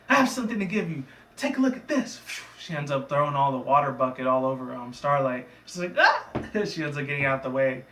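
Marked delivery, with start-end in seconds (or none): 0.1-2.2 high, excited character voice; acted pony taunt. Pony announcing a water attack. 6.0-6.3 very high, loud "ah"; short cartoon yelp; startled mock shriek. Pony dodging thrown water.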